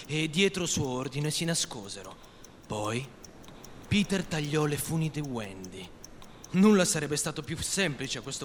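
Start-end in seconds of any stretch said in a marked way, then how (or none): none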